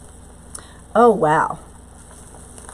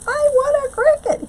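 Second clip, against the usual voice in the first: retold excited demand, much higher and a bit louder; excited squeal; 4 dB louder than usual. Telling husband she wants a Cricut, in a high excited squeal. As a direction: squeal it like a kid begging for a toy.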